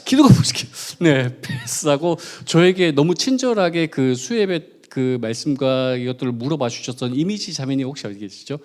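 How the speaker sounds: ordinary delivery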